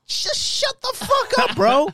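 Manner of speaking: very high, drawn-out plea; mock exasperation. Friend retelling the rambling interruption, high mock-exasperated outburst.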